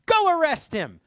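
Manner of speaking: an imagined king ordering his guards; commanding order. Pretend. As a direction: bark the command at soldiers. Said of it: "Does Ishbosheth say to the soldiers".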